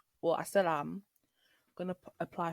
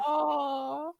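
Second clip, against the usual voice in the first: high loud emotional oh; moved gasp; 9 semitones higher, 6 dB louder than usual. Retold or imitated. Reacting to her friend's monologue with a high moved "oh". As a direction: cry out as if touched. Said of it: "I was like".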